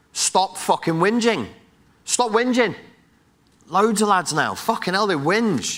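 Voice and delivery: real hyped-up yelling at the audience. Real, not acted. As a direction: bark it at the lads, fired up. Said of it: "yelling at you in my ephedrined out state".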